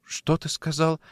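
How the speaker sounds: stammered, softly startled question; taken aback. Acted.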